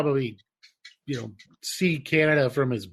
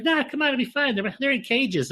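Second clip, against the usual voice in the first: higher, light coaxing wife; a hint of a cheerful wife; mock wife; 9 semitones higher than usual. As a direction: breezily coax him, it'll be fine. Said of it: "And she's like".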